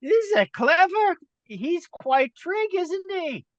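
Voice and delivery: very high, loud creature voice; high-pitched gleeful monster, drawing out the words; giddy laughing head. Acted. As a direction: squeal with mocking delight. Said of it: "laughing one's like".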